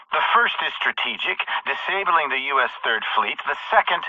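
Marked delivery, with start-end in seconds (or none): none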